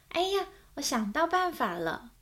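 a mild mock cry of discovery; mild exclaim. Mother suddenly hitting on an idea, excited. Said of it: "妈妈大叫".

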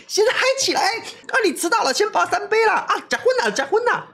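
very high rowdy KTV shouting; rowdy party shout. Retold or imitated. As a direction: whoop it up like drunk friends at karaoke. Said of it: "大家约在K T V里面啊". (KTV里喧闹的高声喊叫)

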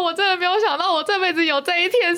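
high, tearful plea to the gods; retold crying plea. Praying to the gods at the temple, high tearful plea. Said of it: "我就这样一直跟个神明哭".

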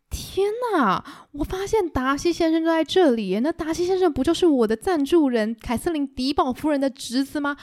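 a fawning clergyman spotting his patron's nephew; excited Mr. Collins. Acted. 柯林斯先生跑来兴奋地说. (高亢兴奋的柯林斯先生)

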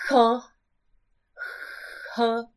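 "呵" led by an audible puff of air; airy H sound. Shown as a demonstration. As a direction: push air through "呵" as a demo. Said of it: "好像有气音". (“呵”先送气再出声)

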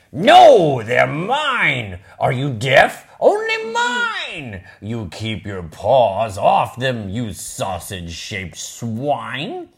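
greedy dog snarling his claim; a spoilt pet guarding his toys; possessive pug. Acted. Reads Pig's possessive lines.

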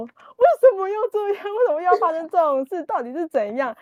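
high-pitched mock-desperate questioning; retold wailing. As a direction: imitate a frazzled parent crying out, without real sobs. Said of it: "大哭说".

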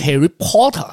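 Harry Potter in a light British accent; a playful British Harry Potter. Shown as a demonstration. Joking to friends about British English, in a light British accent. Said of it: "啊，英国腔".

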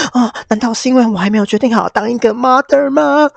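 high, loud melodramatic whine; dramatic girlfriend. Retold or imitated. Clara, dreading talk of breakup, answers in high melodramatic whine. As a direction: play up Clara's panic for drama. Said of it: "她因为还在drama之中".

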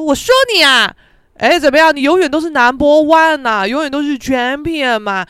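loud, high, cocky taunt; classless bragging. Acted. Student gloating at a classmate, crass and loud. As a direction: taunt a rival with brash swagger. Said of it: "看我要多没水准就好".

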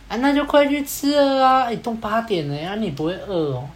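mock-fierce nagging, starting high and dropping; 凶, pushy. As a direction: imitate a pushy guy hurrying someone along. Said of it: "啊，你不要对人家那么凶啊".

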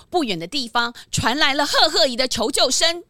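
ordinary talk

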